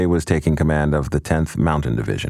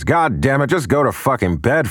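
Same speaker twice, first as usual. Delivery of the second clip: exasperated uncle; 9 semitones higher than usual. Retold or imitated. Uncle snaps at brother at night.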